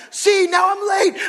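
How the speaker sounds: high, loud, slow wail; hammed-up yell of lateness; mock panicked shout. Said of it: "I'm like".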